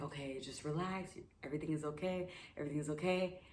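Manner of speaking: a hushed self-reassurance under her breath; calming myself. Retold or imitated. Scared paraglider calming herself: hushed, soft. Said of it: "And I'm like".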